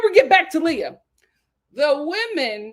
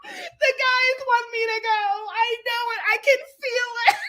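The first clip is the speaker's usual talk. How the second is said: mock whining Janae; 9 semitones higher than usual. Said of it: "Janae is like".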